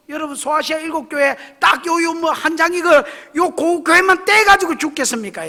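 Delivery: loud, high, driving sermon voice; full-force preaching. Acted. Preacher pressing the congregation on the Laodicean church, at full force. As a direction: preach it hard to the back row.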